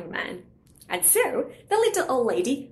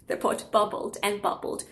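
plain reading voice

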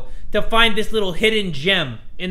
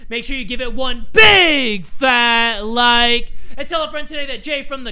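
slow, louder hype call; hype outro; 5 semitones higher, 4 dB louder, slower than usual. Real, not acted. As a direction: build it up like a hype man. Hyped outro plea.

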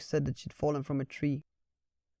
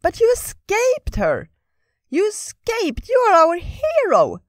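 very high, loud squirrel cheering; excited squirrel; 10 dB louder, slower than usual. Acted. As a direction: squeal it like an eager little squirrel. Squirrel Ratatosk speaks.

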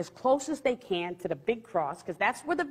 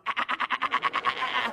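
staccato "ah-ah-ah" machine-gun noise; imitated rapid gunfire; 3 semitones higher than usual. Imagining commies firing from the helicopters, imitated gunfire. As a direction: rattle off short bursts like a gun. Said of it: "they're just gonna go".

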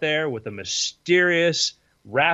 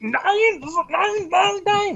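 very high frantic "nein" tantrum; having a fit; much slower than usual. Acted. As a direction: throw a mock German fit. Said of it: "he's, like, having a fit down there".

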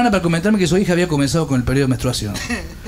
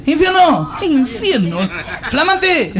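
shouted nicknames; 6 semitones higher, much slower than usual. Retold or imitated. Shouted barrio nicknames again.

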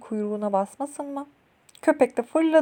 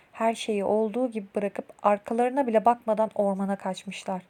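ordinary delivery

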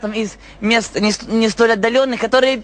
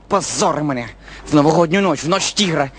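low gruff voice; 10 semitones lower than usual. Acted. Low voice lamenting.

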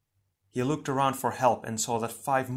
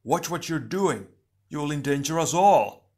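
raised, urgent shout; higher, louder cry of alarm; alarmed warning; 5 semitones higher, 6 dB louder than usual. Acted. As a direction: shout a rebuke to a reckless comrade. Comrade shouts warning over lava.

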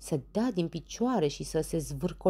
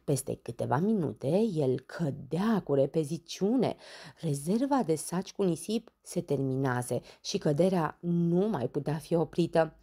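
ordinary delivery

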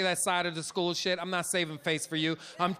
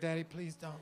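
softly pleading; 4 semitones lower, 12 dB quieter than usual. Retold or imitated. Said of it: "He had the saddest look".

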